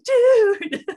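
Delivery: high, loud mock wail of "Dude."; all caps text. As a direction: cry it out like a distraught friend. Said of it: "all caps".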